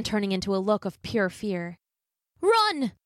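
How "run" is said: high, loud warning cry; urgent shout. Acted. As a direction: yell for her to flee. Liam warns the Selkie.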